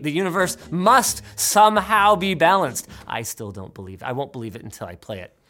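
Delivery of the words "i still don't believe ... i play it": muttered aside. Real, not acted. Skeptical aside.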